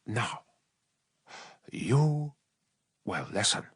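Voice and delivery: soft, slow, deliberate threat; quiet menacing command. Acted. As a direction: lean in and compel him. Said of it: "He leaned in close".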